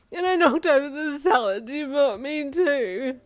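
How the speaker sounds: high, loud, slow bawling. A narrator's reading. Yin bawling over the spilled salads, high and loud. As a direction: sob it out harder. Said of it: "You bawled harder".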